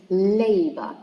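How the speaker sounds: "labor" with a loud, drawn-out LA; teacher's demo of "labor", LA-bor; stress on first syllable. Shown as a demonstration. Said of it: "stressed in the first syllable".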